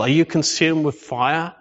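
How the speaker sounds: normal talking voice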